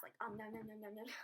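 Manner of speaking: playful mock munching sounds; nom nom eating noise. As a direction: imitate gobbling the apple. Imitating fast apple eating.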